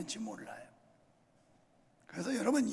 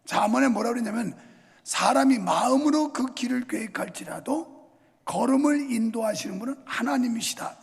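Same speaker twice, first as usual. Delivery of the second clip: usual speaking voice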